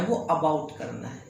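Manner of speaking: ordinary talk, nothing marked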